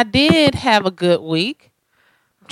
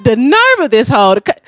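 indignant rant; 6 semitones higher than usual. Retold or imitated. Upset sister rants about girl.

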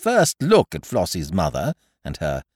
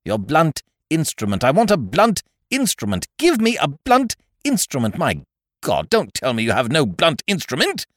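insistent outburst, climbing high and loud; exasperated demand; 8 semitones higher, 5 dB louder than usual. Acted. Visitor demands a weapon to use on Ukridge, exasperated.

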